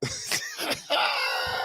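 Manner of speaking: shrieking high laugh; high squealing laugh. Real, not acted. Streamer cracking up at the rap video, shrieking laugh.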